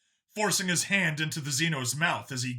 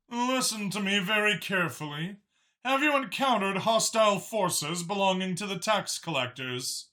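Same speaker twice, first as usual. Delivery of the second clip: higher, measured counselor voice; 6 semitones higher than usual. Acted. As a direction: speak carefully like an official. Counselor Mach speaks over comm.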